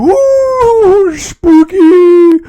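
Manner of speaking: high, loud ghostly hoot; spooky woo. Acted. Spooky cheer about the movie.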